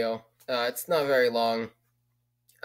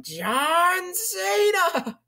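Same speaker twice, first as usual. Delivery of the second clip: hugely high, stretched-out announcer yell; John Cena meme shout; 4 dB louder, much slower than usual. Retold or imitated. Dubbed into the drug ad as the punchline, huge stretched announcer yell. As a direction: belt the name like the meme announcer. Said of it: "the whole John Cena meme".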